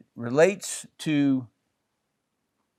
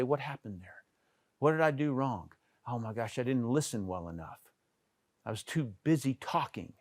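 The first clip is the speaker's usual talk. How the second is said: self-review; 2 semitones lower, 8 dB quieter than usual. Retold or imitated. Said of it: "go back and say".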